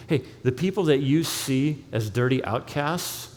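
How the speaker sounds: usual speaking voice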